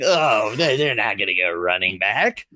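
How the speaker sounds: a low, mocking take on the naysayers; mock doubter. Analyst mocking fans who say no running back, low and sneering. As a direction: lightly send up the critics who doubt it. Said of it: "everybody out there saying".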